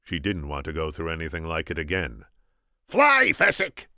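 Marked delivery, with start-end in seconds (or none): 2.9-3.9 very high, loud, drawn-out scream. A narrator's reading. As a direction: scream a desperate order. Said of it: "the Sicilian screamed".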